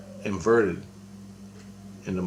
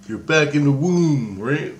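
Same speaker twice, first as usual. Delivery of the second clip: higher, louder, drawn-out quote; wife's exclamation, retold; 6 semitones higher, 5 dB louder, slower than usual. Wife telling him where he is, imitated exclamation. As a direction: stretch it out as you imitate her.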